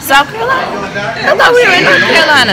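high, loud disbelieving question; surprised. Real, not acted. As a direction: caught off guard, fast and loud. Corrected about which state they're in.

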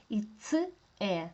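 ordinary delivery

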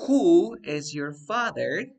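slow, with "Who" long and loud; teacher highlighting "Who", drawn out; stress on WHO. Shown as a demonstration. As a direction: punch and stretch "Who" as the key word. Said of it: "¿quién es el sujeto?".

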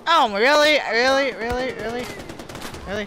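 loud exasperation fading to a mutter; exasperated, fading. Real, not acted. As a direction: start annoyed and loud, then trail off. Frustrated repeats, fading out.

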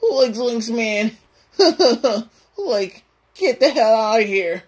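high, giggly cartoon slacker; Shaggy. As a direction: squawk like a goofy high-voiced sidekick. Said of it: "Shaggy swear".

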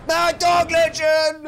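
slow, loud, high yell; drawn-out yell. Real, not acted. Streamer yelling praise at a donor, slow loud shout. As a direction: stretch the cheer out long. Repeats his legend yell.

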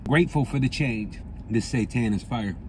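chewing while talking; eating a sandwich; mouth full. Real, not acted. Seen 4: Holds torta, closed-mouth chewing to 2.6; later hands free, plain talk.

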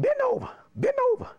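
high, quick mock order; caricatured high-pitched command; imitating the principal. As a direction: rap it out twice in a put-on high voice. Principal orders pupil to bend over.

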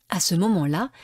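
ordinary delivery

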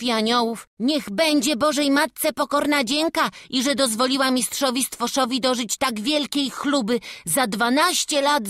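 bright eager lad speaking to the family; character voice. Acted. Young church helper inviting the family in, eager.

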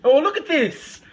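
high, loud alarmed protest; excited. Real, not acted. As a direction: cry out in laughing disbelief. Reacting to the sauce poured.

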